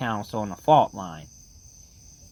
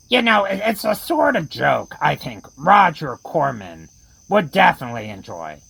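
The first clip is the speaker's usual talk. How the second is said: usual speaking voice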